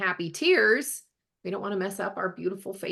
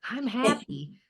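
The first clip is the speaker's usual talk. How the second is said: higher, put-on voice; acted happiness claim; 7 semitones higher, slower than usual. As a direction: play at declaring a mood, pitched up. Acting out incongruous mood exercise.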